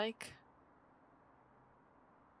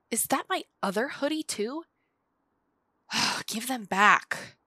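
louder and higher, exasperated; angry demand; 4 semitones higher, 9 dB louder than usual. Acted. Demanding her hoodie back from the friend, loudly exasperated.

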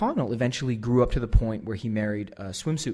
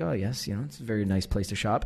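polite, soft and lower; Tim Gunn; 3 semitones lower, 8 dB quieter than usual. Retold or imitated. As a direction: murmur courteous small talk, restrained. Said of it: "Tim Gunn's very polite".

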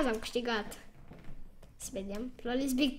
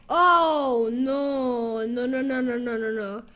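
loud, dismayed oh no then a run of no's; 5 dB louder than usual. Real, not acted. A player dismayed as the opponent's push lands, loud. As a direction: cry out in dismay as the enemy attacks. Losing a battle.

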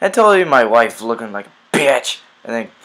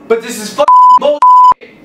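explosive scream, much higher; furious yell; 12 dB louder than usual. Acted. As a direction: blow up at the son. Strict dad explodes at son.

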